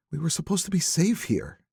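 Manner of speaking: a hint of a wife's bitter disbelief; Delia, grieving. A narrator's reading. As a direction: widow laments her safe community. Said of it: "Delia Sanderson said".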